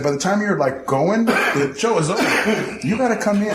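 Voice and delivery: come in hot. Retold or imitated. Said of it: "teach my man the little art of".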